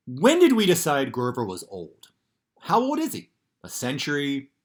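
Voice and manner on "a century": usual speaking voice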